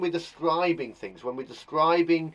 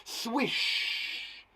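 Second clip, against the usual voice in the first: long airy "shh" on the end; drawn-out, hushed swish; swishing sound; 6 semitones higher, 4 dB quieter than usual. Shown as a demonstration. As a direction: let the word sweep away like air.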